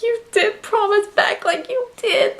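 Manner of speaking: high, teary plea to a character; half-crying at a heartbreaking scene; retold sobbing. Fan pleading with Beck in a voice near tears. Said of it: "sobbing 'cause I was like".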